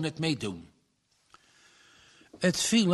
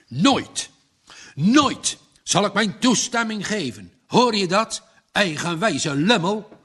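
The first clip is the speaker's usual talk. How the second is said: louder, higher furious shout; 5 semitones higher, 6 dB louder than usual. A narrator's reading. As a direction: roar at the stubborn son. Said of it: "schreeuwde zijn vader". (vader schreeuwt woedend naar zijn zoon)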